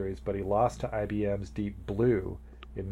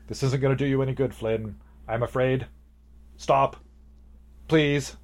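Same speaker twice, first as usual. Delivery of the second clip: raised, louder retold plea; MCP starting to panic; 4 semitones higher, 7 dB louder than usual. Player retelling the computer pleading with Flynn, mildly panicked. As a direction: voice a computer's mild panic, pushing harder on each plea. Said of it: "The M C P starts to panic".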